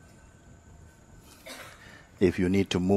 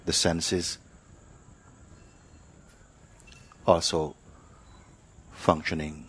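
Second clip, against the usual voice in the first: guided meditation; 4 dB quieter than usual. Acted. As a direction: ease them into stillness, one phrase at a time. Teacher leads silent meditation.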